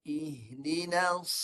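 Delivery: "Ihdinas" drawn out slowly with a thick emphatic sad; heavy sad. Shown as a demonstration. Ustaz demonstrating the thick sad, slow. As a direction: hold the sad heavy and long. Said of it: "Dia siram sad tebal".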